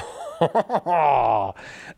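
loud, drawn-out laugh. Real, not acted. Reacts to a teased revelation.

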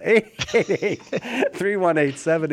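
reading the number out through a laugh; laughing while giving the phone number. Real, not acted. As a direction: giggle your way through the digits.

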